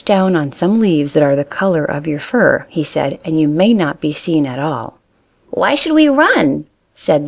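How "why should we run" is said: put-on high, slow childlike question; a bunny who doesn't understand; puzzled little rabbit. A narrator's reading.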